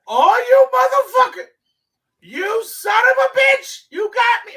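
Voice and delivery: high, loud, drawn-out cursing; mock outraged shout. Rider off the bus yelling at the joking driver, mock outrage. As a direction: yell it like you've been had.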